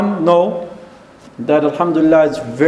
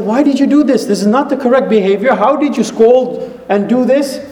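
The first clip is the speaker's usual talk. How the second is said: higher-pitched retold reproach; upset wife; 5 semitones higher than usual. Wife scolding her husband over his mother, upset. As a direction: take him to task, voice raised in pitch. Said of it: "She got upset".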